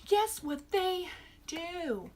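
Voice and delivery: slow, drawn-out repeat of the hook; slow teaser. Real, not acted. Reviewer repeating the tease to her viewers, slowly drawn out. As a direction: drag it out slowly, stress on guess.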